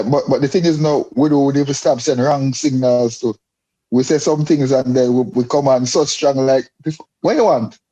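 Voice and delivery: normal talking voice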